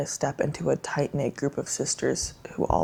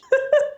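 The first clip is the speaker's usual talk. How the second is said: laugh-cry; 4 dB louder than usual. Real, not acted. Emotional reaction to the book's ending.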